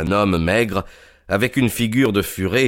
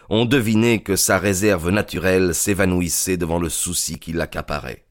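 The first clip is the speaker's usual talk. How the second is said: ordinary delivery, nothing marked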